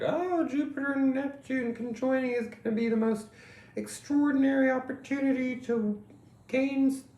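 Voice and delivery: very high, loud mock-gushing voice; weird high-pitched Neptune voice. Tarot reader mimicking people thrilled about Jupiter and Neptune, weird high-pitched voice. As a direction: put on a silly, squeaky voice for over-excited believers. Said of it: "that very weird high-pitched voice".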